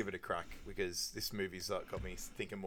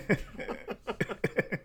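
breathy laugh; 10 semitones higher than usual. Real, not acted. Laughing after his own joke.

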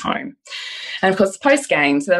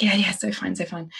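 much softer, trailing off; breezy mumbled reply; 8 dB quieter than usual. Retold or imitated. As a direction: toss it off offhandedly. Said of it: "And she's like".

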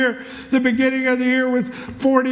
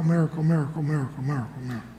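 much lower and softer, fading out; voice of the Lord; 9 semitones lower, 7 dB quieter, much faster than usual. Retold or imitated. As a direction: sink into a deep, dying divine echo. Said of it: "And then the Lord said".

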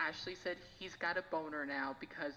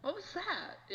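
much higher, questioning wife voice; imitating wife; slower than usual. Husband imitating his wife asking about the podcast. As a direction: play the curious wife asking what she just heard. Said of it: "she's gonna go".

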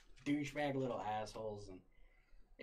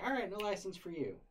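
higher, singsong brush-off; mock official dismissal; 8 semitones higher, 3 dB louder than usual. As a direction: mimic the office turning him down. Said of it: "So they're like".

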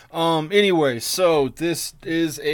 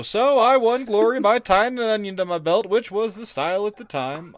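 put-on higher rambling old man voice; a higher-pitched, old man voice telling a pointless story; Grandpa Simpson; 8 semitones higher than usual. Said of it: "I imagine Nestor's Grandpa Simpson".

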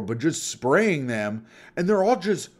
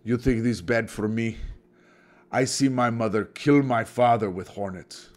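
mock Eastern European accent; 5 semitones lower than usual. Hornet exterminator shrugs off his day, in mock Eastern European accent. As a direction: put on a deadpan Eastern European accent, low. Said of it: "that's a very racist accent".

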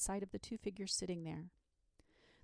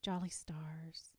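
slow, soft murmur; 2 semitones lower, 5 dB quieter, much slower than usual. A narrator's reading. Dragon gazing at the stars after the banquet, slow murmur. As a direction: murmur it drowsily, almost whispering. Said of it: "he murmured".

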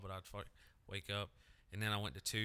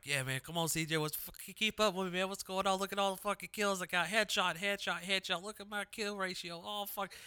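high, bragging mock gamer voice; cocky gamer self; 9 semitones higher than usual. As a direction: hype yourself up, pitched much higher. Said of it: "And I was like".

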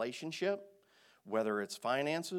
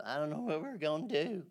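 worried whining; 3 semitones higher than usual. Retold or imitated. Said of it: "not what".

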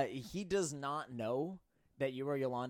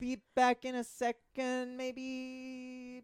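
high put-on voice; 11 semitones higher, slower than usual.

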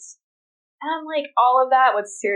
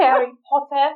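bad, hammed-up British accent, louder; clumsy mock British accent; terrible British accent; 6 semitones higher, 4 dB louder than usual. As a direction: mangle Harry Potter in a British accent on purpose. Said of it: "Excuse my terrible British accent".

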